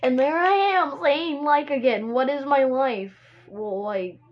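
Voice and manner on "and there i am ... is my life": higher, louder comic outburst; loud exasperation. Real, not acted. Host catches herself saying like again, loudly exasperated. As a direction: cry out in playful despair at yourself.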